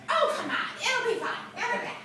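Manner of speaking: high-pitched, dismissive reassurance; imitating a breezy friend; coaxing friend. Friend coaxing her into date.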